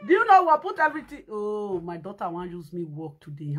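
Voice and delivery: normal talking voice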